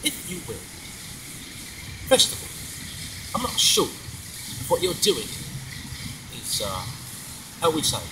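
soft, halting British butler; Michael Caine. Acted. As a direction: impersonate the film butler with long pauses. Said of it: "And he goes".

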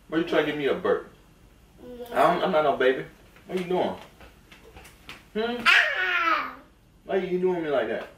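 high-pitched teasing complaint; playful mock protest.

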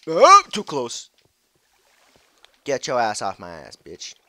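scared loud yell at a creeper; panicked yell. Real, not acted. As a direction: yell it as it gets close. Creeper too close.

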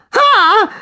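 very high, loud shriek; terrified squeal; panicked scream. A narrator's reading. Worm being cast into water, panicked scream.

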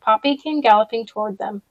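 plain reading voice

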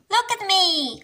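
high-pitched storybook cry; excited cry. A narrator's reading. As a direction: call out for attention, high. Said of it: "cries Peppa".

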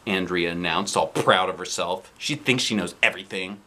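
ordinary delivery

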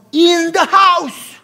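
loud, high, stretched punchline; forceful preaching emphasis. Real, not acted. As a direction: cry out the key phrase to the congregation. Preacher hammers the key phrase.

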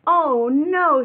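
high, loud oh no; a hint of a shocked principal; dismayed principal. Principal Nancy watching the playground collapse, high and loud.

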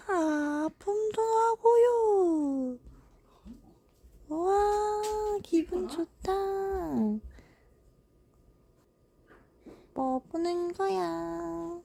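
slow, soft, lightly voiced baby; voicing the baby. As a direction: drawl it like a relaxed infant. Voicing the baby after a bath. (아기 목소리 흉내)

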